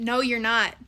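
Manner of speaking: ordinary delivery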